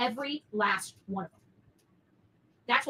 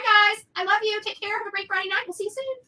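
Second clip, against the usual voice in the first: usual speaking voice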